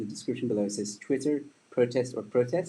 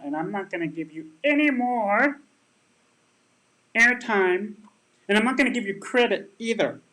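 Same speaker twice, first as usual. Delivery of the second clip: mocking arrogant debater; 9 semitones higher, 4 dB louder than usual. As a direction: sneer like a smug keyboard warrior refusing airtime. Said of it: "they're like".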